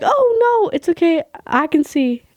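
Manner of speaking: high little voice easing down; nervous politeness. Retold or imitated. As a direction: imitate an awkward, placating reply. Nervous polite answer, acted.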